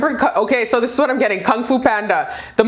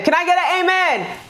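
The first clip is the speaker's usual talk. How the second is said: high churchy call for an amen; preacher call; 8 semitones higher than usual. Pretend. Vlogger playfully asking viewers for an amen, preacher style.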